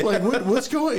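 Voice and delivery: high, loud, flustered protest; mock bewilderment. Guest scolded by friend over canned food, bewildered. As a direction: stammer it high in exaggerated confusion.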